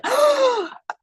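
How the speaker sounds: loud, high surprised gasp; sharp gasp. Retold or imitated. Gasping as she smells the candle upstairs. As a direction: gasp in sudden discovery.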